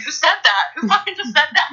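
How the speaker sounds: very high-pitched incredulous question; mock astonishment. Client reacting to her own inner voice, high mock astonishment.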